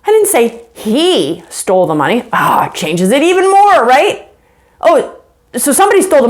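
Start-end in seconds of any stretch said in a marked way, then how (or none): 0.1-2.2 "he" set apart, raised and lengthened; pause, then lean on "he"; stress on HE. Shown as a demonstration. Teacher shows meaning changes even more, stress on "he". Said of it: "Or you could say".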